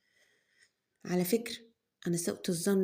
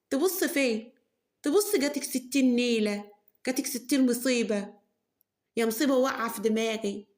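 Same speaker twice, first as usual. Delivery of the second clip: mother berating; 3 semitones higher, 3 dB louder than usual. Acted. Mother berates unmarried daughter.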